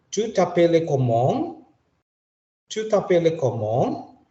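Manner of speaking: "Tu t'appelles comment?" slow, rising at the end; rising question. Shown as a demonstration. Teacher reads "Tu t'appelles comment?" aloud, rising. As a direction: ask "Tu t'appelles comment?" slowly, lifting the end. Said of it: "kita akan baca".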